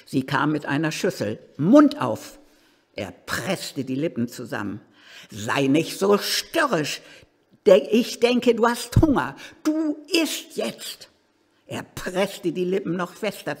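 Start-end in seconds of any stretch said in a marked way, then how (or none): 1.6-2.4 harsh command. Acted. Annemarie forcing him to eat. 5.3-11.1 stern forcing. Acted. Annemarie force-feeding the old man.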